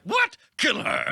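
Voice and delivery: very high, loud monster outburst; loud, high-pitched alien yell; Venom. Acted. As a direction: burst out in an exaggerated creature voice. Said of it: "And Venom's like".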